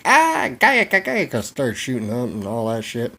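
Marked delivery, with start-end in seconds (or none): 0.0-1.4 high, loud imitated battle cry; hammed-up high gibberish shout; mock enemy soldiers yelling. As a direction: yell like startled soldiers in a war yarn. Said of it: "they looked up and they're like".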